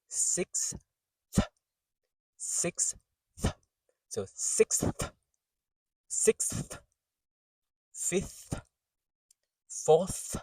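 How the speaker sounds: "sixth, fifth, fourth" with a separate, breathy th at the end; exaggerated final TH. Shown as a demonstration. Teacher exaggerating word endings, overdone TH. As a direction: detach and hold the th after "six". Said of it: "That's how you would say it when it's exaggerated".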